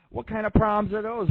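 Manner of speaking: mock nurse voice. Caller voices a nurse's question.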